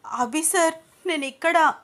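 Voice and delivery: calling out. A narrator's reading. Ananya behind a tree calling to Abhi, high call. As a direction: cry out to be found. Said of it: "అని అరిచింది అనన్య".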